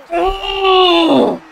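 long, high, loud goal scream; celebrating yell. Real, not acted. Streamer celebrating his goal, huge drawn-out yell. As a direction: let out a huge held "oh".

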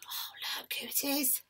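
breathy hushed aside to the class; whisper. Real, not acted. Teacher's secret aside to class.

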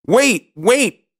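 high, urgent princess cry; high-pitched storybook pleading. A narrator's reading. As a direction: call out to stop the frog. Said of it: "cried the princess".

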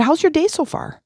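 bright, high, friendly question; cheery greeting. Retold or imitated. Shopper cheerfully greeting the complaining cashier in a high bright voice. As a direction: chirp it brightly at the grumpy cashier. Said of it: "I turn around, and I said".

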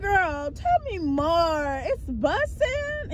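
high, drawn-out delighted question; retold girly egging-on, stretched slow; playfully intrigued. Playful reply to child's compliment.

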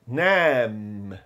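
"nem" with a long drawn-out vowel; stretched vowel. Shown as a demonstration. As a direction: hold the vowel in "nem", low pitch. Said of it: "você deve esticar o som dessa vogal".

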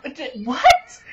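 loud, sputtering disbelief; stammer, then blurt it loudly; stunned reaction. Retold or imitated. Fan retells seeing the abrupt credits, stunned outburst. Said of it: "I was like".

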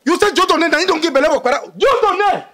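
high, incredulous outcry; shocked shouting. Acted. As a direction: repeat the scandal at a high pitch. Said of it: "Not the shout, not the shout".

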